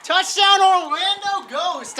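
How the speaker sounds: shouted high and excited; touchdown call. Real, not acted. Touchdown scored, live call.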